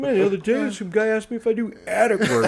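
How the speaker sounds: high, loud telling broken by laughter; laughing retelling. Retold or imitated. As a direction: tell it while cracking up. Retelling pickup line, laughing.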